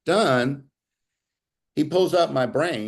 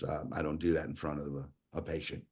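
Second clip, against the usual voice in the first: low, much softer retold reply; reluctant aside; 8 semitones lower, 11 dB quieter, faster than usual. Doctor balking at showing a patient the bad scan, quietly reluctant. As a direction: drop low and quiet, imitating a hesitant doctor.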